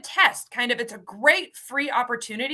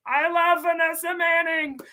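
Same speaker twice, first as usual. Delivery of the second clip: enthusiastic shout; 6 semitones higher than usual. Real, not acted. Host praising a case manager, excited shout. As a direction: gush about her.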